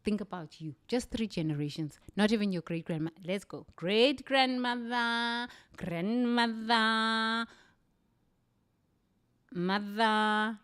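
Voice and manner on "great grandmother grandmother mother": each word stretched on a held, raised note; long, sustained chant-like naming; drawn-out roll call. Shown as a demonstration. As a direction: count them off slowly, holding the last vowel. Counts off generations, sounding each out.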